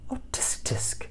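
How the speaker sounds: soft whispered little tut; cheeping tisk. A narrator's reading. Little bird tutting over the mess. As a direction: tut softly like a small bird. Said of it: "cheeped Kalinka".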